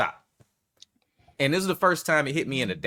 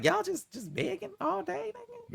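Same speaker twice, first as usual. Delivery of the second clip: mock exasperation. Complaint to the begging women, acted.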